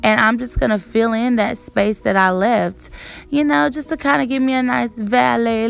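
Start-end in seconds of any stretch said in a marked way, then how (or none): none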